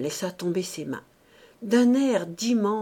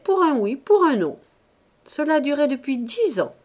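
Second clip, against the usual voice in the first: raised, higher storytelling officer; retired captain; 6 semitones higher, 5 dB louder than usual. A narrator's reading. Old captain telling gossips an army tale, raised. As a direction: relish the barrack anecdote aloud.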